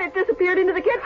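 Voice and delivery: ordinary delivery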